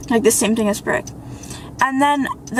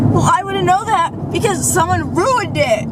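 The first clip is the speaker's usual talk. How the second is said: high, swooping indignant retort; indignant comeback; 6 semitones higher than usual. Retold or imitated. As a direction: act out a pointed, aggrieved comeback. Pointed comeback about spoiled surprise.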